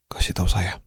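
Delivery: breathy whispered plea, barely any voice. Acted. Whispering to the crying girl he guards, begging her to tell him. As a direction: whisper it close to her ear, pleading.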